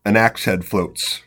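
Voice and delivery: normal talking voice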